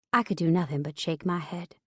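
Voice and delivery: ordinary delivery, nothing marked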